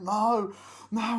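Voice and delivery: high, loud cry of refusal; loud acted pleading; mock protest shout. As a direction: shout it like a victim under attack. Victim protesting in retold attack.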